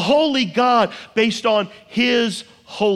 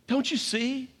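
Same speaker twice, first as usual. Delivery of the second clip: soft plea, pitched up; 2 semitones higher, 8 dB quieter than usual. Shown as a demonstration.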